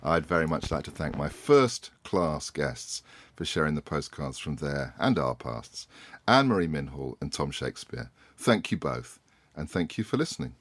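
low, unhurried host outro; podcast sign-off. Acted. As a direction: wrap the show up calmly. Host's closing sign-off.

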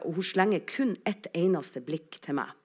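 usual speaking voice

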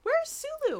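hammed-up baffled Spock; mock Spock. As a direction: squeak it in confusion. Re-voicing Spock, far high.

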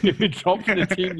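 high, loud announcement of being dropped; mock boss. Friend playing his own coach dropping him, high and loud. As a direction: play the manager breaking bad news.

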